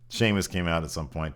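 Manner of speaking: normal talking voice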